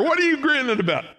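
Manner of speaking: high, fast teasing question; mock-curious asking; playful question. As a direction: tease them into joy. Acted question-answer.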